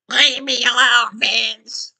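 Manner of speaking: high, loud hag scream; evil witch scream. A narrator's reading. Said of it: "She screamed at him in that evil voice".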